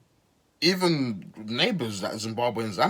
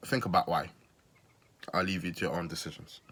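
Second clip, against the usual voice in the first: ordinary talk, nothing marked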